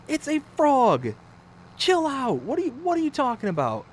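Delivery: high, loud incredulous retort; baffled husband losing patience; exasperated. Retold or imitated. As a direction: argue back, pitch soaring. Husband argues with panicking wife.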